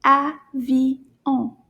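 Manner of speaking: "Avion" in three separate beats; "Avion" with a gap after each syllable. Shown as a demonstration. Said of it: "that's three claps".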